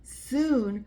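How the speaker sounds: usual speaking voice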